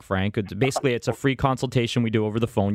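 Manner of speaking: ordinary talk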